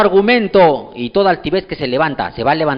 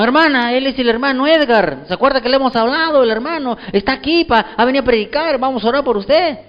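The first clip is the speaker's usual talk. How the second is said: lightly raised, earnest voice of the church members; church members telling her; 9 semitones higher than usual. Retold or imitated. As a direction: voice them a touch higher, coaxing the sick sister. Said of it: "le dijeron". (hermanos de la iglesia animando a la enferma, voz algo más aguda)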